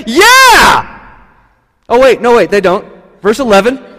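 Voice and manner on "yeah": high, loud whoop; joyful cheer. Pretend. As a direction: cheer like a crowd jumping up. Disciples jump up cheering.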